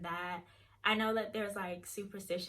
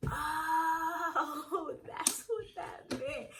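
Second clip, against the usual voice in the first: high drawn-out "oh" of realization; retold sheepish penny-drop moment; dawning realization; 8 semitones higher, 4 dB louder, much slower than usual. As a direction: let it slowly sink in, pitched up.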